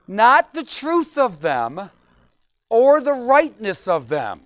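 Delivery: slow, loud and deliberate. Shown as a demonstration. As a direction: spell it out slowly for the slow ones. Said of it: "For some of you, they're a little slow".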